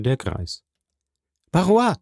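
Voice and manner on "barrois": high, raised call. A narrator's reading. Valentine summons the old servant, calling out. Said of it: "rief Valentin".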